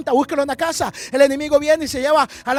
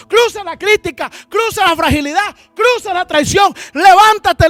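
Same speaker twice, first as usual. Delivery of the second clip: high, shouted preaching in repeated bursts; fiery prophetic rebuke; 4 semitones higher, 7 dB louder than usual. Acted. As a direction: challenge a weeping man to get up. Preaches as priest rebuking David.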